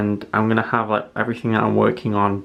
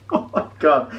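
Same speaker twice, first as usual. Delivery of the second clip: ordinary talk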